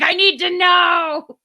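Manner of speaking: high, slow, loud plea; desperate shout. Retold or imitated. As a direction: cry out to know the ending. Desperate hooked quote.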